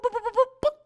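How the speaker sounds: high, rapid bomb beeps; quick high-pitched boops; imitated beeping. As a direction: mimic an electronic countdown. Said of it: "you hear this boop".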